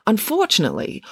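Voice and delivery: ordinary delivery, nothing marked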